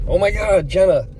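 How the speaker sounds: imitating viewers' delighted squeal; excited recognition. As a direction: gush like a fan hearing the name. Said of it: "they're gonna be like".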